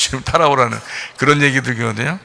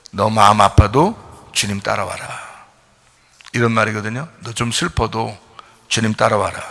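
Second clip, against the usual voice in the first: gentle, low call; 5 semitones lower, 5 dB quieter than usual. Pretend. Preacher voices Lord's gentle call.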